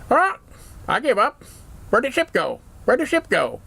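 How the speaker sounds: high squawking parrot voice; squawky parrot. Acted. Parrot squawking at the dad about the ship.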